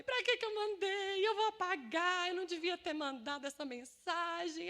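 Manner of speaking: high, loud wail easing down; panicked regret. Retold or imitated. Woman regretting the audio she sent, hammed-up panic. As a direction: replay a mock panic of regret. Regrets sending message, no reply.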